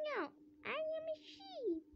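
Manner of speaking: high little sheep voice, insisting. Acted. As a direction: speak as a tiny sheep. Sheep answers in its voice.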